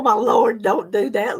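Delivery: ordinary talk, nothing marked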